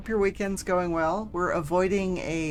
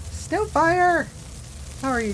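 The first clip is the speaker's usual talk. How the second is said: excited; 12 semitones higher, 5 dB louder than usual. Real, not acted. Greeting chatter.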